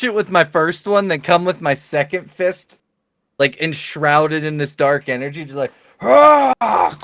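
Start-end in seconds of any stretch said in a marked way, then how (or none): 6.0-7.0 sharp high attack yell; battle cry. Acted. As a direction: cry out as you strike. Punching enemy with dark fist.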